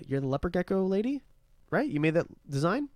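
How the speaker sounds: normal talking voice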